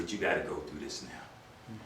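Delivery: softly voiced officer talking a prisoner down; calming officer. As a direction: settle the scared kid down gently. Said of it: "He calmed me down".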